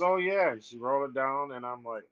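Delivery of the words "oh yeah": upbeat. Retold or imitated. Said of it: "she's, like, upbeat".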